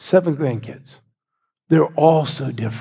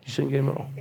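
much softer, almost under the breath; hushed repeat; 8 dB quieter, much faster than usual. Shown as a demonstration. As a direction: pastor repeating to the crowd, softly. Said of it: "So most of you shouldn't get him at all".

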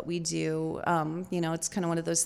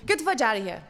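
mock New York brush-off; gruff New York cop; 3 dB louder, faster than usual. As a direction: lightly imitate a dismissive New York cop. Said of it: "a New York tater hater".

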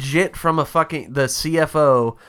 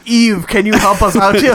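high, loud fake English accent; bad English accent; 8 semitones higher, 8 dB louder than usual. Podcaster asking Eve for help, bad English accent. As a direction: call out in a botched English accent. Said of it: "cannot do the English accent either".

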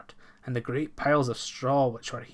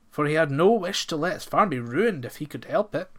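ordinary delivery, nothing marked

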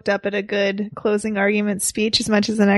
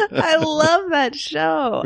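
sarcastic; 7 semitones higher, much slower than usual. Acted. Sarcastic after laughing.

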